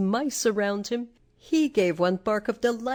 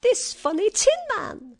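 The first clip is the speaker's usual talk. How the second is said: high-pitched little mouse; squeaky mouse queen; 13 semitones higher, 5 dB louder than usual. A narrator's reading. Mouse queen tells her mice of her rescuer, squeaky voice. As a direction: answer in a tiny royal squeak. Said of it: "she answered".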